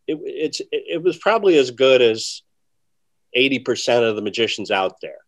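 ordinary talk, nothing marked

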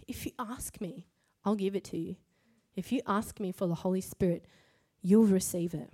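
soft, quiet and gentle; tender offer. Retold or imitated. Jesus promising the woman the Spirit, soft and gentle. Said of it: "he says".

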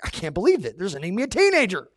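mocking Goliath. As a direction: scoff at the boy sent out. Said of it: "He was like".

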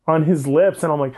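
ordinary talk, nothing marked

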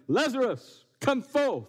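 ringing loud command. Acted. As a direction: summon him out of the grave loudly. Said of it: "in a loud voice".

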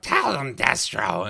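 lightly voiced villain appealing to his henchman; Cobra Commander. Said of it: "Cobra Commander is all kinda".

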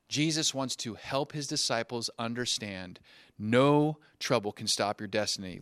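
ordinary talk

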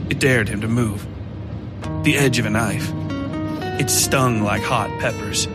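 ordinary delivery, nothing marked

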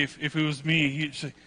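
normal talking voice

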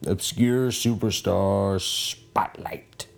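slow, drawn-out announcer read of the title; show title announcement. Acted. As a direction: stretch each word like a ring announcer.

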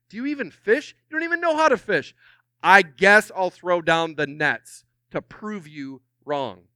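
rising outrage, voice jumping up as the insult builds; indignant rant. Acted. Simon snapping at Jesus over the nets, an indignant shout. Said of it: "He's saying".

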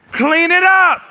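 put-on high, loud yell at the kids; parent's scream. Shown as a demonstration. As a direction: scream it at them like a fed-up parent. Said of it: "You scream at 'em".

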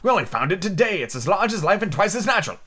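high, lilting presentation; eager. A narrator's reading. Heiga introducing Alice to the unicorn, eager high voice. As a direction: show her off eagerly. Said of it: "Heiga repeated eagerly".

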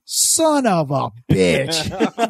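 very slow, drawn-out grumbling curse; impersonating dad's slow exasperated swearing; grumpy dad cursing. Retold or imitated. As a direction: drag it out like a fed-up dad. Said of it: "Why do you make him sound like".

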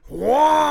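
loud, high comic "whoa" yell; mock grab noise. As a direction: act out the twist with a big yell. Imitates twisting grab, comic noise.

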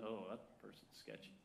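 quiet aside as if thinking it; muttered private judgement. Acted. Acts out silent judging thought.

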